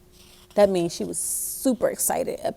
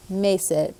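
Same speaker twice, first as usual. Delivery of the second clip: ordinary delivery, nothing marked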